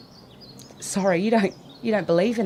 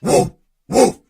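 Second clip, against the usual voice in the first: louder dog-bark "woof"; 5 dB louder than usual. Acted.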